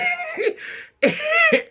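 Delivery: high, breathless laughing fit; squealing with laughter. Real, not acted. Shrieking with laughter over the friends.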